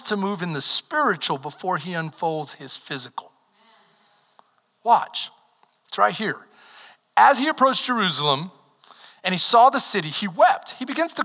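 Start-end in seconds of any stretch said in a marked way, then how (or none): none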